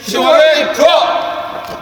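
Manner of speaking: loud echoed safety call; shouted check call. Real, not acted. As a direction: bark back the checklist item.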